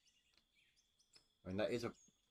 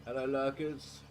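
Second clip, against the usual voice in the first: cheery call-out; 7 semitones higher, 6 dB louder, slower than usual. Real, not acted. Calling out greeting to chat.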